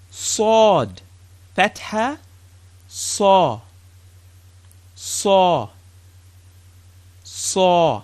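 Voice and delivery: teacher demonstrating emphatic "saw"; full mouth s. Shown as a demonstration. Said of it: "It's like a full mouth scene".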